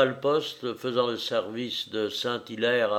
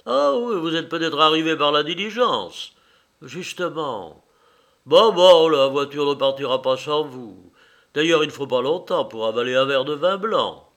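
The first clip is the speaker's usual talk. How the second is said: put-on lively old priest, louder; cheerful insistent country priest; jovial priest; 3 semitones higher, 6 dB louder than usual.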